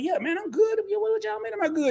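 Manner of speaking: high, playful what-do-you-mean; mock puzzled reply. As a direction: act baffled at the fuss. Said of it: "And I'm like".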